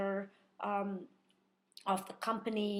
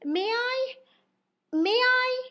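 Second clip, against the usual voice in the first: high, sweet may I; polite asking; 11 semitones higher, 6 dB louder than usual. Retold or imitated. Imitating Luis asking permission, high. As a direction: lilt it up politely. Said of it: "it's like".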